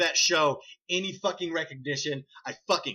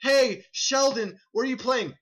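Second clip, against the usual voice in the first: high, exaggerated sitcom setup; hammed-up sitcom character; mock sitcom dialogue; 7 semitones higher than usual. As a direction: play a cheesy sitcom line, pitched up.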